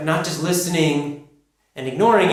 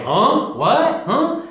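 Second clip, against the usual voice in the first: high, sharply rising puzzled questions; confused listener; 8 semitones higher, much slower than usual. Acted. Listeners failing to understand a learner's English, confused. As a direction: act baffled, pitch shooting up on each word. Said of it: "and act confused when you speak".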